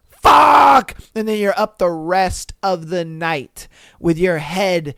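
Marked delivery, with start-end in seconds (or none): 0.1-0.9 loud, high shouted curse of realization. Acted. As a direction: yell it as it suddenly hits you. Realizing he missed the signal.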